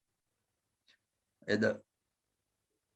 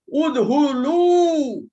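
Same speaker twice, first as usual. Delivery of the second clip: long drawn-out shout, much higher and louder, very slow; commanding shout; 10 semitones higher, 8 dB louder, much slower than usual. Acted. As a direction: order everyone indoors with one stretched yell. Ant queen orders ants inside.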